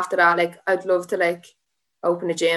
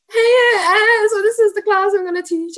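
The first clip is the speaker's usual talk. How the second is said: much higher, louder, chirpy put-on brightness; forced cheerful greeting; 13 semitones higher, 8 dB louder than usual. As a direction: fake a bright hello to hide tears. Forced cheer after crying, retold.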